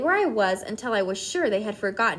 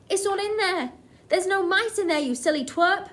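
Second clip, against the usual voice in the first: shouting; 8 semitones higher, 3 dB louder than usual. A narrator's reading. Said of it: "were shouting".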